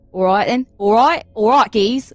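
Cockney accent warm-up. Shown as a demonstration. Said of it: "What's my trigger phrase?".

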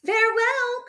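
crying out. A narrator's reading. Fox mom bidding her young farewell, crying out. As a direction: call goodbye in a high voice. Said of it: "cried their fox mom".

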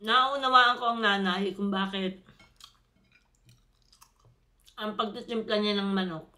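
talking with food in mouth; speaking between bites; chewing while talking. Real, not acted. Seen 3: Eats chicken by hand; closed, working lips between phrases show chewing.

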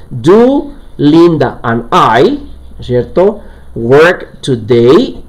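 very slow, drawn-out English question; rising, careful dictation; slow model sentence. Shown as a demonstration. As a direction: spell it out word by word for the class. Corrected version read slowly.

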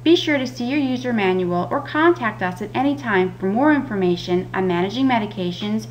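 usual speaking voice

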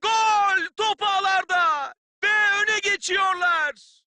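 screaming the goal call, high and loud; screaming football commentator. Real, not acted. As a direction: explode with joy at the goal. Goal scored, commentator erupts.

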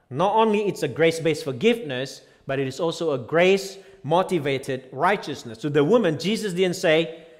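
high, loud fiery preaching; preaching at full force. Acted. Pastor thundering at the congregation about grace. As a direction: drive the point home to the congregation.

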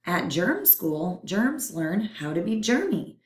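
ordinary delivery

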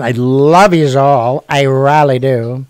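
slow, loud and loving; big affectionate goodbye; heartfelt sign-off. Real, not acted. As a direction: tell them you love them, drawn out.

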